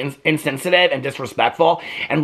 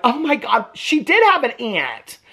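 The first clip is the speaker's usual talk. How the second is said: high-pitched, drawn-out imitation of awe; mock amazement; 8 semitones higher, slower than usual. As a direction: imitate gullible sisters marvelling, much higher. Retold amazed reaction of sisters.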